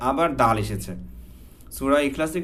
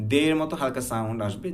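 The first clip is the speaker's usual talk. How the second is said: usual speaking voice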